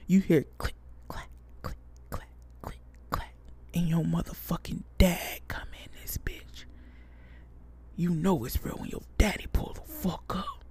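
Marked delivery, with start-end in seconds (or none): 0.6-3.3 whispered clicks. Acted. Dad arriving, footsteps heard. 3.7-10.6 dramatic whisper. Acted. Dramatic entrance of dad.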